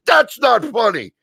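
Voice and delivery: high, loud imitation of indignation; mock offended protest. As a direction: protest loudly in comic offence. Voicing James's offended protest.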